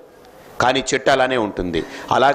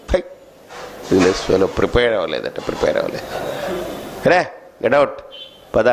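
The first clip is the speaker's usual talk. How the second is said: a lightly voiced scornful Yama, lower and partly hushed; Yama dismissing; 6 semitones lower than usual. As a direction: brush them off as the god of death would. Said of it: "యముడు మటుకు". (యముడి తిరస్కార స్వరం, కాస్త తక్కువ స్థాయిలో)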